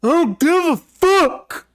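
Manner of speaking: high shrill-pitched furious yell at the dog; angry kid yelling. Retold or imitated. Gamer kid screaming at his barking dog, furious high yell. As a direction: scream it like a bratty kid losing it. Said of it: "And he's like".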